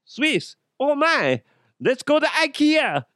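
high-pitched, silly fake Swiss accent; bad Swiss accent. Joker settling on a fake accent, hammed-up Swiss. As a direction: squeal it in a made-up Swiss accent. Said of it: "I don't know if that's a Swiss accent or not".

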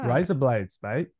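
"Rise up lights" run together like Australian "razor blades"; Australian accent. Shown as a demonstration. Trying the trick just taught, Australian accent. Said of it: "it sounds like razor blades in Australian".

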